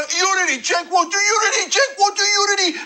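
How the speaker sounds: high mocking shout of unity. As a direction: yell the slogan mockingly. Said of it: "we'll say".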